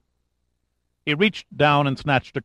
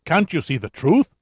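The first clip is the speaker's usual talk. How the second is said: higher, incredulous appeal; near shock; 5 semitones higher than usual. A narrator's reading. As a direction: appeal to him, close to shock. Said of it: "Lugovoy said in near shock".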